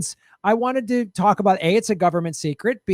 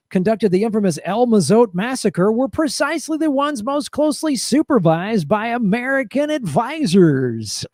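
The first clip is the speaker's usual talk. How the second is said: raised, building announcer read with a stretched last word; showy quiz host delivery; game show host; 4 semitones higher than usual. Acted. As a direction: build to the reveal, then draw it out. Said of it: "read about death squads in the game show voice".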